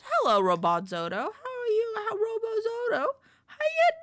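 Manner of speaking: loud, high squealed hello; excited greeting. Real, not acted. Greeting a viewer effusively.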